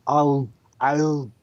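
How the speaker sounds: put-on slow, drawn-out Austrian accent; slow Austrian "I'll be back"; Schwarzenegger impression. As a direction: deliver the catchphrase slowly, like Arnold. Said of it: "huh, Arnold?".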